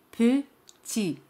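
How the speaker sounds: petit said slowly in two syllables, no T at the end; petit, ending cleanly on the i sound; silent final T. Shown as a demonstration. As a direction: say petit with the final t left mute. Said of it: "no se pronuncia".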